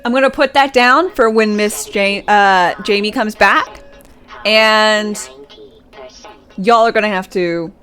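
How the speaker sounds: a hint of a stern substitute teacher; lightly voiced scolding teacher. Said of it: "Substitute teacher voice".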